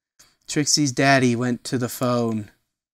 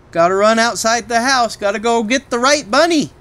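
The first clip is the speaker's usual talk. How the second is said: higher, louder, rushed urgency; urgent; 10 semitones higher, 9 dB louder than usual. A narrator's reading. Narrator urging them to get the right bunny, higher and louder. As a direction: push forward like you must hurry.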